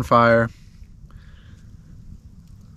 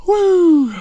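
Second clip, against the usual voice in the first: excited whoop; 9 dB louder than usual. Real, not acted. Cheering at a glorious morning.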